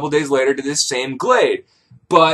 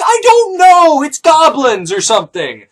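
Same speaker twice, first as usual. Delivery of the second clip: high, loud mock-desperate wail; exasperated yell; 11 semitones higher, 7 dB louder than usual. Man defending himself to the crowd, yelling desperately. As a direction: cry out helplessly at full pitch.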